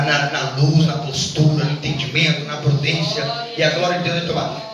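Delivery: ordinary talk, nothing marked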